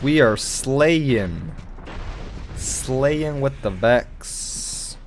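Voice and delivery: very slow, stretched celebratory words; drawn-out gloating. Real, not acted. Gamer celebrating kills with the Vex, slow gloating. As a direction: savour each word of the boast.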